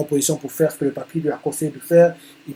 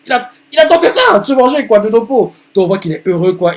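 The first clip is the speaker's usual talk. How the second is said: excited; 7 semitones higher, 8 dB louder than usual. Real, not acted. Excited retelling of revenge.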